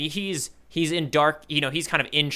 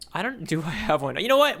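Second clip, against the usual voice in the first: ordinary delivery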